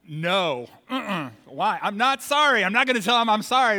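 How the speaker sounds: put-on loud, high-pitched childish refusal; defiant kid. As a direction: protest like a stubborn little brother. Acting defiant child refusing parents.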